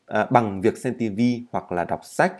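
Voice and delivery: ordinary delivery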